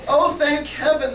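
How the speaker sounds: slow, high, loud relief; drawn-out, hammed-up drunken relief; relieved drunk sigh. A narrator's reading. Drunk to cop on hearing his wife fell out, relieved sigh. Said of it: "sighed the drunk".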